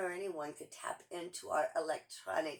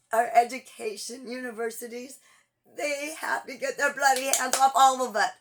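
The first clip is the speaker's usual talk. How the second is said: loud and higher, hammering each phrase; rally shouting; 8 semitones higher, 11 dB louder than usual. Real, not acted. Urging Americans to march, shouting at full voice. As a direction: shout it like a protest rally speech.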